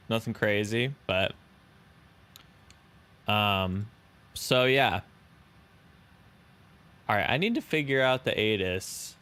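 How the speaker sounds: ordinary talk, nothing marked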